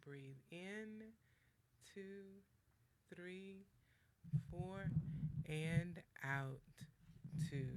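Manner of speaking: soft, slow breathing count; guided breathing count. Acted. Coach leading a breathing exercise, soft and slow. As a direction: count them gently through each breath.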